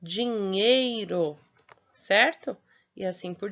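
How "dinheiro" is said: dinheiro stretched out slowly, syllable by syllable; teacher drawing out dinheiro; slow, clear model word. Shown as a demonstration. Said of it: "Quando a gente fala ou escreve".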